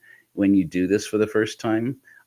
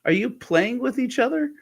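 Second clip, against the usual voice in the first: baffled dad. Retold or imitated. Dad puzzled at boys on separate computers, high baffled voice. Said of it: "and he goes".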